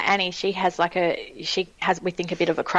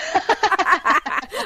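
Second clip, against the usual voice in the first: high laugh; 11 semitones higher than usual. Real, not acted. Laughs at the surname joke.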